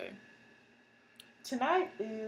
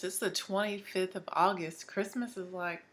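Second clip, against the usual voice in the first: normal talking voice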